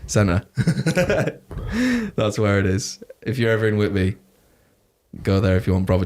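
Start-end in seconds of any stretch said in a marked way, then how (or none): 0.5-2.1 long high laugh. Real, not acted. Laughs about the bar.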